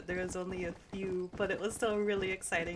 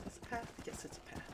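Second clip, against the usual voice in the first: softer, half-whispered to herself; whispered aside; 5 semitones higher, 9 dB quieter than usual. Real, not acted. To herself while playing the game, a soft whisper. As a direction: murmur it under your breath.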